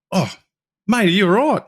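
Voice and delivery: high-pitched startled check on a mate; acted-out stranger's alarmed concern; concerned surprise. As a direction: call it out high, like you've stumbled on a mate in trouble. Strangers find him upset, acted quote.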